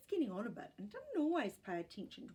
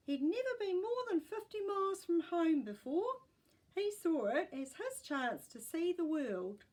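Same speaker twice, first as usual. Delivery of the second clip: put-on high, louder old woman's voice; lightly voiced great gran remembering; 9 semitones higher, 6 dB louder than usual. A narrator's reading.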